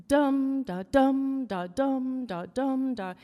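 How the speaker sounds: chanted DUM-da, each dum loud and long; trochaic meter. Shown as a demonstration. Teacher showing a class stressed first syllables, chanted trochaic stress. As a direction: stress every dum, drop lightly onto da. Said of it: "the first syllable is stressed".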